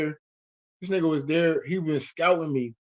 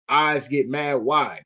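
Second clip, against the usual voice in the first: ordinary delivery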